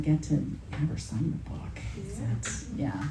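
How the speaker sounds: a real hushed whisper, breath only. Real, not acted. Host whispers an aside to the author about signing. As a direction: drop to a whisper as if nobody else should hear.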